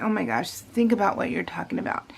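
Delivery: whispered aside to yourself; hushed, exasperated self-talk; muttered self-scolding. Real, not acted. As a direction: drop to a low whisper, telling yourself off. Mutters to herself mid-ramble.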